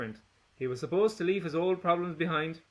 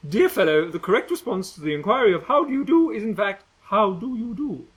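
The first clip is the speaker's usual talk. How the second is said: prim correction; 7 semitones higher, 7 dB louder than usual. Acted. Gretchen correcting manners.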